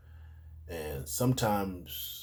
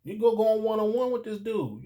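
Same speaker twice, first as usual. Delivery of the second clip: higher pitch, mock disbelief; incredulous surprise; 13 semitones higher, 5 dB louder than usual. Asked to back up a schoolmate, high incredulous surprise. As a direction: act baffled at the request. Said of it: "I'm like".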